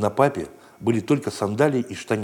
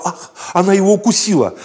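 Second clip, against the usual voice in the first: loud, higher alarmed cry; grandma's cry; 9 semitones higher, 6 dB louder than usual. Acted. Grandmother crying out as the dog bites her grandson, loud. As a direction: gasp and shout in alarm. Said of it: "Бабушка кричала".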